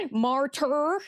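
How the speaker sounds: Martyr said with an American r, rising; hesitant American accent; trying American accent. Shown as a demonstration. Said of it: "I don't know how to do an American accent".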